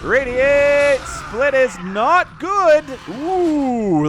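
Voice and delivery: loud high-pitched dismay; excited dismay. Real, not acted. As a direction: groan at a blunder, loud and high. Caster reacts to bad split.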